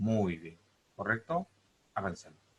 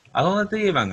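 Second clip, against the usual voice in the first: calling out; 11 semitones higher, 10 dB louder than usual. Real, not acted. Teacher quizzing class loudly.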